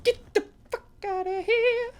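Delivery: real terrified yell with a laugh; scared shriek. Real, not acted. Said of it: "That scared me for real".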